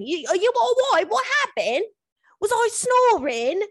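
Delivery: high put-on voice, bewildered questions; mocking impression of a baffled bedmate; imitating confused man. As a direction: mimic his baffled fretting way up high. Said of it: "very confused".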